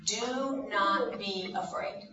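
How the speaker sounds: slow, deliberate "Do not be afraid"; slow demo line. Shown as a demonstration. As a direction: say it slowly for the kids to repeat. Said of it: "Can you say that?".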